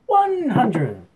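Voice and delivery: high, loud drawn-out darts caller's call; referee voice. Shown as a demonstration. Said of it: "get our referee voice on".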